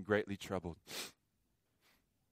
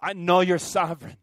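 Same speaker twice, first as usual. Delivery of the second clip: louder earnest plea to God; pleading prayer; 5 semitones higher, 6 dB louder than usual. Acted. Preacher voicing a believer praying to God, loud and earnest. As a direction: lift it up as a prayer. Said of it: "we pray".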